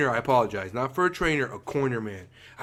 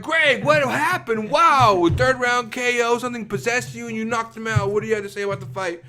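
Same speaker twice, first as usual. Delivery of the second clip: sports interviewer; 10 semitones higher, 5 dB louder than usual. Retold or imitated. Post-fight interviewer.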